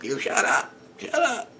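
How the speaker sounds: high, mock angry hush at the dog; imitated angry hush. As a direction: replay yelling at the dog, but softly. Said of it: "me getting all angry".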